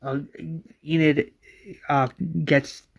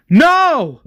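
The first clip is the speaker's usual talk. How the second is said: loud, high acted scream; anguished shouted cry; 14 semitones higher, 12 dB louder than usual. A narrator's reading. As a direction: scream it out, far above speaking pitch. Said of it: "Ryan screams".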